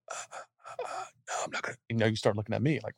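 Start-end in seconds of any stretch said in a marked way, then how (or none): 0.1-1.8 faint, airless and much higher, a throat full of sand; parched croak. Retold or imitated. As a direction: barely get the words out, dried up and weak. Dehydrated man refusing water, croaking.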